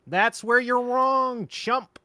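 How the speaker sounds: slow, high drawling impression; David Lynch. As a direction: stretch every word out slowly. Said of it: "sounds that much like David Lynch".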